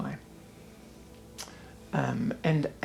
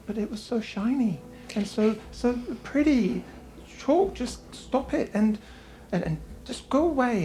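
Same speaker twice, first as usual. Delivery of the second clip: high pleading pixie voice, slowing; upset pixie; 8 semitones higher than usual. Acted. Pixie snapping back at her friend, high and whiny. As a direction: whine it out like a hurt little pixie. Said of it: "and she's like".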